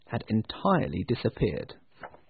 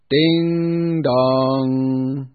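long ringing ding dong like a church bell; bell chime; 6 dB louder than usual. A narrator's reading. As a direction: chime it out loud and slow.

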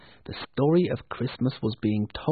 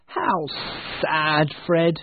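a little angry; 3 semitones higher, slower than usual. Acted. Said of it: "a little angry".

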